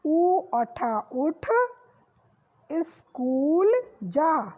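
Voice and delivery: careful classroom read-aloud; slow reading aloud. Shown as a demonstration. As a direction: read the sentence out slowly, word by word, for children. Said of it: "reading the sentence slowly".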